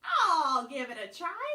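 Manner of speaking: volunteer as the pig, loud first word; pig character. A narrator's reading. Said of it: "Pig said".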